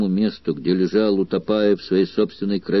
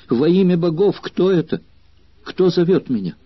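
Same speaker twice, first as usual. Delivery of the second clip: high, louder frightened cry into the dark; frightened loud question; 9 semitones higher than usual. A narrator's reading. Girl calling out in fear to an unseen voice, loud and high. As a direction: call out in terror, not knowing who is there. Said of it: "громко спросила".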